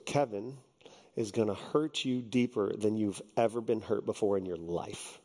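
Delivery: low, quiet, grave warning; counselor's hushed, deadly serious warning; dead serious pastor. Retold or imitated. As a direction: deliver it like a solemn prophecy. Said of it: "He was, like, dead serious".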